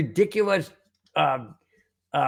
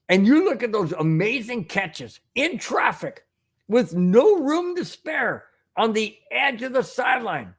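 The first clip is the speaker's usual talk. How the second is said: usual speaking voice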